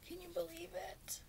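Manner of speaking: soft breathless whisper of disbelief; awed whisper. Real, not acted. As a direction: whisper it like it's too precious to say aloud. Awed by the brushes.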